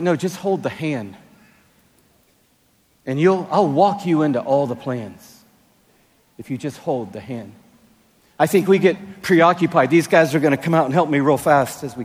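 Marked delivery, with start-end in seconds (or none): 0.0-7.5 softer, gentle and reassuring; God gently answering. Retold or imitated. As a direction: soothe them, lean on hold. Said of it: "He's like".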